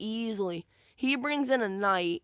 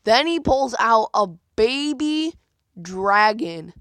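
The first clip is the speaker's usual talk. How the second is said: louder and slowed down, drawing out the reveal; dramatic reveal; 7 dB louder, slower than usual. Real, not acted. Gamer telling viewers about the opponent's card, loud slow dramatic reveal. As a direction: build suspense on the card drop.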